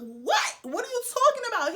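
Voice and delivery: high, loud retold "what?!"; alarmed disbelief. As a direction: act out baffled alarm, pitch jumping up. Retold alarmed reply to friend.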